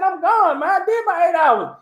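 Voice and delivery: high, breezy mock of himself; self retold. He tells coworker he's leaving, high breezy voice. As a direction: toss it off and head out. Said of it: "I said".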